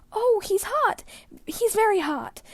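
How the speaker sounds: high, loud, anxious cry; worried. A narrator's reading. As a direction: blurt it out in a panic over the fevered man. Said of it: "he muttered worriedly".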